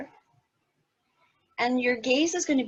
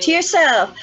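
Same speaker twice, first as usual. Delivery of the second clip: excited call; 8 semitones higher, 10 dB louder than usual. Acted. Laughter yoga cheering.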